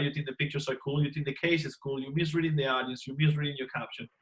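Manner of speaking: usual speaking voice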